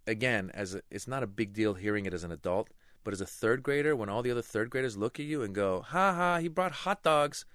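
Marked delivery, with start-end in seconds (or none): 5.8-7.4 higher, louder taunting "ha ha"; slow mocking kid taunt; mocking classmates. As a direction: tease him like third graders.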